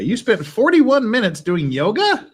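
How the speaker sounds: high, loud, slow disbelieving question; incredulous. Real, not acted. Incredulous at app.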